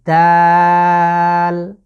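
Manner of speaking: দাল drawn out and loud; long Arabic dal. Shown as a demonstration. Teacher shows viewers the letter dal, drawn out. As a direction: hold দাল for three counts. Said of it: "গোড়ার সাথে লাগিয়ে".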